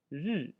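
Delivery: "Ri" said with a sharp fall for learners to repeat; fourth tone ri. Shown as a demonstration. Teacher giving a word to repeat, falling tone. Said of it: "Ri, just try to say after".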